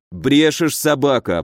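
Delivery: ordinary delivery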